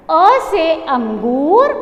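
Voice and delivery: drawn-out, slow model phrase; slow teaching recitation. Shown as a demonstration. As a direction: stretch it out for children to repeat. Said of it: "बोलो मेरे साथ". (बच्चों के लिए धीरे, खींचकर बोलना)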